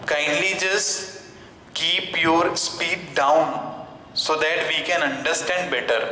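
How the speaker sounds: very slow, careful polite request; a student slowly asking the teacher to ease his speed. Pretend. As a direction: ask sir to slow down, drawing each word out. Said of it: "अपनी तरफ से suggestion भी दे देना कि sir".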